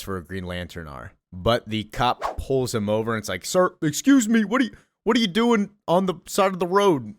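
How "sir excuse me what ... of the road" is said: high-pitched, louder cop voice; questioning cop. Retold or imitated. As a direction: pipe up as a cop pulling someone over. Voices cop questioning Jordan in story.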